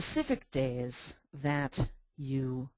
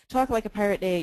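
ordinary delivery

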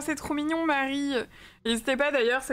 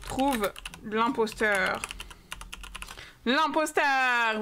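slow, spelled-out dictation while typing; dictating slowly; much slower than usual. Real, not acted. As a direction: drag each word out as you type the title. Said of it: "on met quoi en titre?". (elle dicte lentement le titre du live)

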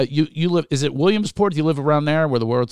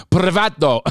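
louder, higher Spanish "privado" with rolled r; imitated Spanish accent; 5 semitones higher, 6 dB louder than usual. Host copying guest's word, exaggerated Spanish accent. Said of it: "you just did that when you said".

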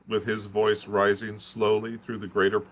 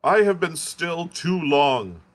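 high, slow and louder, booming out; loud defiant curate; 9 semitones higher, 5 dB louder, slower than usual. Acted. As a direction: curate raising his voice so the pit could hear. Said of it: "in a tone that must have reached the pit".

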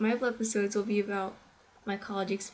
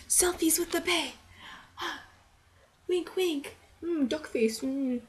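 put-on light, higher selfie-girl voice; party girl; 7 semitones higher than usual. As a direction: coo about selfies, a bit hammed up. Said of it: "college is one of two things".

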